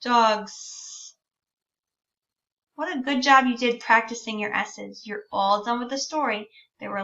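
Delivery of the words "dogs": "Dogs" with the final s held long. Shown as a demonstration. Teacher modelling "Dogs" with a long final s. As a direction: stretch the s at the end of "Dogs". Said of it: "make the S sound a little extra long".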